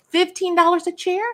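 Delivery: high-pitched questioning offer; tentative offer. Retold or imitated. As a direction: float the price up high, unsure. Retelling her timid offer.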